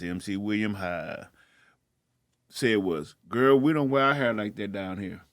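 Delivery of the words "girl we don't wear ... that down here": ordinary delivery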